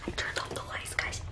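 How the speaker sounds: very soft, secretive whisper; hushed whisper. Acted. Vlogger hiding in the dark, hushed whisper. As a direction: barely whisper it.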